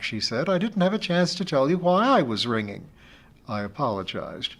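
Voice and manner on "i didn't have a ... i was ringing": higher voiced friend, affectionate; a friend gently teasing her over the phone; friend Amy, fond teasing. Friend Amy teases the anxious teacher, affectionately higher.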